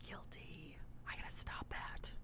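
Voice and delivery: very soft whispered aside; hushed admission of guilt; whispered confession. Real, not acted. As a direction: whisper it like owning up sheepishly. Aside confessing own road rage.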